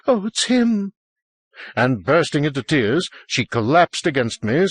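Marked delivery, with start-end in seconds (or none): 0.1-0.9 high, shuddering dread; frightened high-pitched wail. A narrator's reading. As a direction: let a shudder run through it. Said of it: "then a shudder in her voice".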